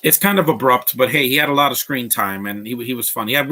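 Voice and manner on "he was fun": ordinary delivery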